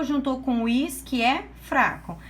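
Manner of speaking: normal talking voice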